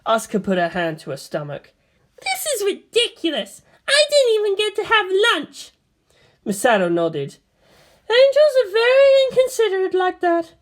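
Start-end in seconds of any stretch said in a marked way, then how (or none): 2.2-5.7 put-on high girl's voice, outraged; whiny girl's complaint. Asuka complaining. 8.1-10.5 high and louder woman's voice, dry; wry officer's quip. Acted. Misato joking.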